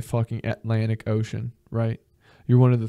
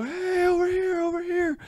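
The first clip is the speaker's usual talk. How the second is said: high, thin call from far away; distant survivors calling. Pretend. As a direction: call out across the water. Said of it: "They go".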